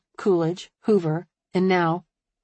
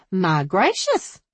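admiring; slower than usual. A narrator's reading. Hairdresser greeting her dressed-up client, admiring. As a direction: gush slowly with admiration. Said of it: "Beulah said admiringly".

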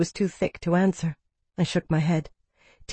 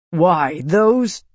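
too furious to finish the sentence; speechless fury; 5 dB louder than usual. A narrator's reading. Ian outraged at news of the Seekers, sputtering fury. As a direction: sputter with anger, rising and louder. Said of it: "Ian's fury robbed him of speech".

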